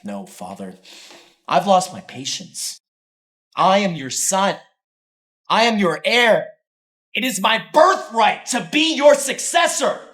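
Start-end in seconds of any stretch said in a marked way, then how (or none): 1.5-10.0 slow, rising, louder with each line; angry defiance. Acted. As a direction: build to a furious claim of birthright. Son defying father.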